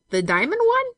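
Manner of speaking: loud, high-pitched disbelief; alarmed question. A narrator's reading. Benson asking Olive which bracelet, alarmed.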